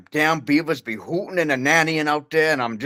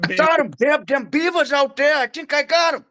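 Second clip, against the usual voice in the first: put-on much higher, twangy old-man voice; hillbilly grandpa; 12 semitones higher than usual. Grandpa bragging about shooting beavers, high old-man twang. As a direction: crow like a proud old hunter. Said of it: "Shut up, Grandpa".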